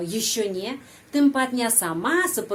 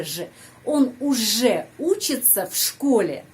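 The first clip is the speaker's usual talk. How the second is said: ordinary talk, nothing marked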